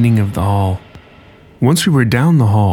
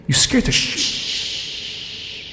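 slow, high breathy whisper; frightened whisper; 11 semitones higher, 7 dB quieter, much slower than usual. Acted. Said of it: "Julie warned".

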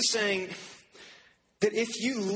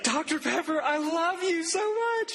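mock tearful gush; 12 semitones higher than usual. Preacher mimicking the weeping winner, high gushing mock sob. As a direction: gush it out high like an overjoyed crier. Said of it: "she was like".

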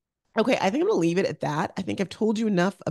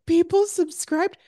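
surprised; 13 semitones higher, 4 dB louder, slower than usual. Retold or imitated. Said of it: "And I'm like".

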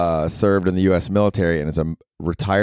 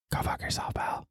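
hushed unvoiced insult; teasing whispered curse at a friend; mock whisper; 13 dB quieter than usual. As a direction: whisper it like a joke threat.

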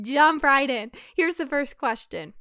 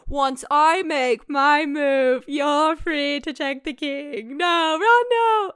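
dramatic movie speech; 2 semitones higher, 3 dB louder, much slower than usual. Acted. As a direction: act out the big heroic film speech. Acting out a memorized movie speech.

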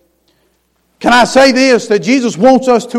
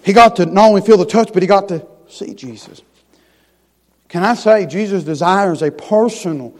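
ordinary delivery

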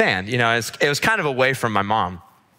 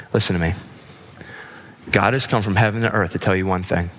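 solemn reassurance; 5 semitones lower than usual. Real, not acted. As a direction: speak low, leaving long pauses to sink in. Preacher applies mom's comfort to listeners.

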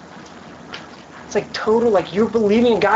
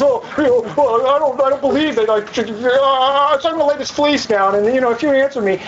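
higher-pitched wavering, hesitant mumble; mock dithering; 4 semitones higher than usual. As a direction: mimic a timid man stalling. Mocking Gideon's dithering.

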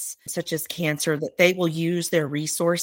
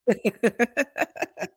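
teary laugh; 5 semitones higher than usual. Real, not acted. Teary laugh after holding tears.

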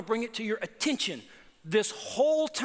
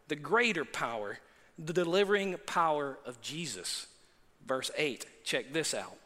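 ordinary delivery

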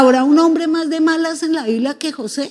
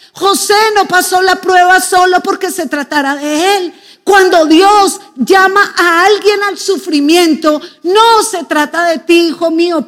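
higher, urgent preacher's call to the flock; fervent preaching; 4 semitones higher than usual. Acted. Preacher urging the congregation to see beyond themselves, fervent and raised. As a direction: press the point home to the congregation.

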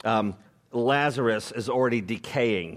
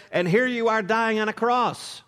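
ordinary talk, nothing marked

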